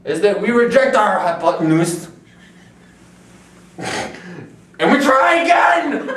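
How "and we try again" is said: shouting through laughter; laughing shout. Pretend. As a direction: yell it while cracking up. Laughing rant about failed experiment.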